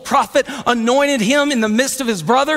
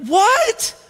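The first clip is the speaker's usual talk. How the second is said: high, disbelieving exclamation; pitched-up bewildered question; incredulous; 8 semitones higher than usual. Acted. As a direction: react stunned at the insult. [name]'s reaction to the insult.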